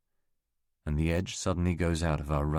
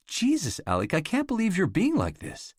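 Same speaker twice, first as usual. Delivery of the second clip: high, loud exasperated cry; stung and furious, voice climbing; angry outburst; 4 dB louder than usual. Acted. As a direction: let her disbelief boil over. Catherine flares up in the argument.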